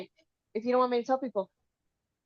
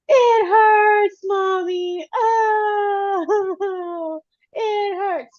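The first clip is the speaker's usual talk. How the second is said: high, loud mock wailing of a child; mock crying child; 11 semitones higher, 5 dB louder, much slower than usual. Mom imitates daughter crying from the bedroom, high mock wail. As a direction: wail like a kid sobbing in the next room. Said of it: "all I hear is".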